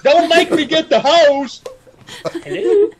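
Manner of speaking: high pitched hammed-up yell calling someone back inside; mock yell. Calling Arlene back indoors, a hammed-up high yell. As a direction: call out across the yard, playfully.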